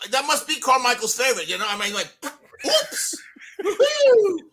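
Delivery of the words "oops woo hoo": laughing oops. Pretend. Mocking tissue joke, laughing.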